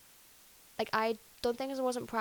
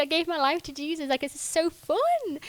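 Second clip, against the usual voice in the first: giddy excitement; 7 semitones higher, 6 dB louder than usual. Retold or imitated. Running to mum, overjoyed.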